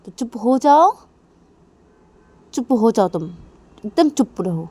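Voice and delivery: loud orders, building in force; angry warning. Acted. Nisha telling Manisha to stop provoking her, angry. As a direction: warn them off with mounting anger. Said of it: "मुझे गुस्सा आ रहा है अभी".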